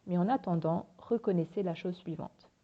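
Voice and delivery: ordinary talk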